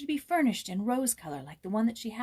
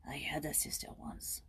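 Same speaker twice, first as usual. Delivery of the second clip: soft, whispered admission; hushed confession; 5 semitones lower, 10 dB quieter than usual. Acted. Old man confessing his sister to Joyce, soft whisper. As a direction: let it slip out barely voiced.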